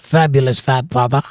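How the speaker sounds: put-on British accent; playful British impersonation. Shown as a demonstration. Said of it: "say that with a British accent".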